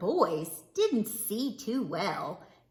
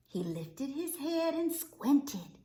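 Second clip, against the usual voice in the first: usual speaking voice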